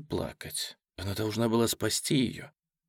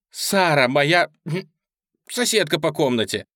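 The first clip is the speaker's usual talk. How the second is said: urgent explanation; 5 semitones higher, 6 dB louder than usual. Acted. Julia urgently explains to Ben.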